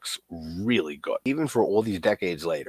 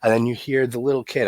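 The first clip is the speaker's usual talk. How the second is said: ordinary talk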